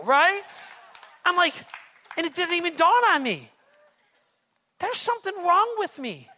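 loud, high, building sermon fervor, slowing to hammer each phrase; preaching at full force. Acted. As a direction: preach it like the crowd is on its feet.

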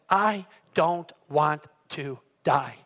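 each word set apart, low and slow; slow, deliberate declaration. Real, not acted. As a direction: say it very slowly, word by word. Dramatic emphatic declaration to congregation.

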